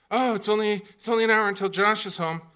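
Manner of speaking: a touch of his wife insisting; imitating his wife. Wife arguing to fetch son.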